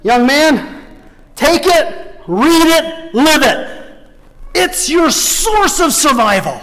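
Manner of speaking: put-on preacher charging a teenager, rising and forceful; old preacher's charge. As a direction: press each command on the young man. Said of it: "he looked at him and he said".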